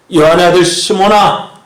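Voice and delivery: loud call, pitched a little up. Acted. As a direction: call Simon firmly by name. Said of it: "라고 부르시는 거예요".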